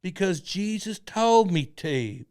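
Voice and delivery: slow, loud preacher line; cult leader voice. Retold or imitated. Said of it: "impersonation of him?".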